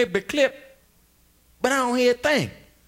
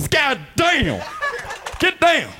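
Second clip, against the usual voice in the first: startled shout to the audience; 6 semitones higher, slower than usual. Real, not acted. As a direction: yell it out in alarm. Comic shouting at crowd commotion.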